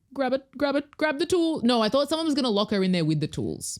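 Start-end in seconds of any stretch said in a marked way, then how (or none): 0.1-1.6 urging the screen. Retold or imitated. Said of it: "I was like".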